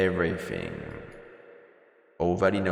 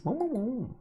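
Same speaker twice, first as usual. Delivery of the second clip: squeaky high creature "mo mo mo"; high-pitched little critter sounds; cute creature chirp; 14 semitones higher, 4 dB quieter than usual. Pretend. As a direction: chirp it like a tiny pet creature. Creature noise in game.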